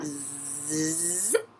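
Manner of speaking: soft buzzing "zzzip" sliding upward; zipper sound. Pretend. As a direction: imitate a zipper being pulled up. Imitating a zipper being pulled up.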